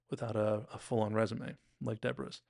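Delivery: ordinary talk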